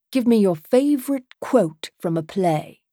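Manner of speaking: put-on British-accented request; a British man's voice, inviting an answer. A narrator's reading. Man asks the playwright for a quote, British accent. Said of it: "that lovely British accent".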